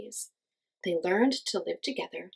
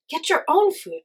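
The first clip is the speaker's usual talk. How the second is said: loud, high, scornful people; mean villagers telling him off; scolding crowd; 9 semitones higher, 6 dB louder than usual. A narrator's reading. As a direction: shout it down at the boy. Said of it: "they said".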